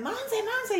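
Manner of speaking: high-pitched excited name call; imitated fan call. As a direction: mimic fans hailing a celebrity. Fans calling out to her.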